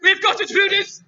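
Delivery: high, laughing fake panic; laughing mock alarm. Host laughing through a pretend stabbing by the intruder. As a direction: cry for help while cracking up.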